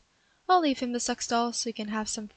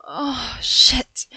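loud, sharp unvoiced curse; startled curse; 7 dB louder than usual. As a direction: blurt it out in shock. Sees his cock.

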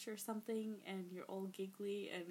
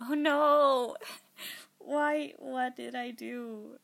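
loud, higher wail easing off; playful dismay; 3 semitones higher, 11 dB louder, slower than usual. Real, not acted. Voice actor stuck on a line, loud giggly despair. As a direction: wail it, then settle toward normal.

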